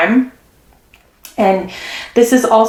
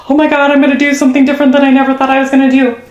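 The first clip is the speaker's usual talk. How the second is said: fast, higher, louder rush of excitement; thrilled realization; 5 semitones higher, 4 dB louder, much faster than usual. Retold or imitated. Reader voices the client choosing themselves, fast and excited. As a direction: blurt it out breathless with disbelief. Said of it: "where you're like".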